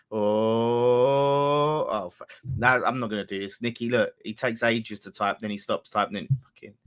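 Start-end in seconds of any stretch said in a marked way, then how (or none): 0.1-1.9 long drawn-out held "ohhh"; suspenseful "ohhh". Pretend. Streamer waiting on a friend's message, mock suspenseful "ohhh". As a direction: hold the "oh" until breath runs out. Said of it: "I've run out of breath".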